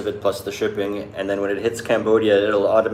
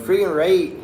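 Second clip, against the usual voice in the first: mock Canadian accent; 9 semitones higher than usual. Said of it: "you're like".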